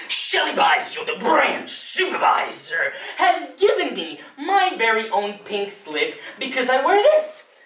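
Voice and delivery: put-on animated librarian talking down to small children; lilting storytime voice with pointed swells on key words; Uncle Carl. Librarian explaining his pink slip to children, animated storytime voice.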